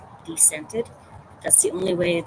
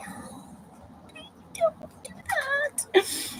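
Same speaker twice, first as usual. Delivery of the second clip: pleading whine; 6 dB quieter, much slower than usual. Retold or imitated. Parent pleads, baby asleep.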